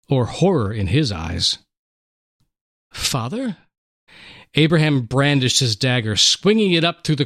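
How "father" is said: frightened. Acted. Boy frightened by father's eyes.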